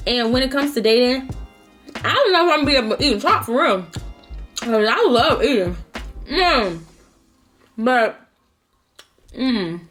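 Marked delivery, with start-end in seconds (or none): 2.0-9.8 talking while chewing; words round a bite of food; mouth full. Real, not acted. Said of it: "excuse me for smacking".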